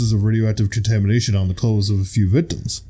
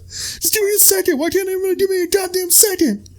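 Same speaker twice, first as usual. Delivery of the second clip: much higher, exasperated put-on voice; movie quote impression. Quoting Mark Wahlberg's movie line in a high exasperated voice. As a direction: beg for a second in a squeaky frazzled voice. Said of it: "Name that movie".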